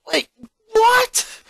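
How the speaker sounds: high, loud sudden wait what; alarmed disbelief; startled dad. Acted. Father hearing the suit may be damaged, startled. Father reacts to bad news.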